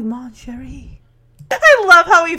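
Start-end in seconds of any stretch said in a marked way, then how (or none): 0.0-1.0 softly imitated French accent. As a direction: put on a soft French lover's accent. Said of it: "He puts in a French accent".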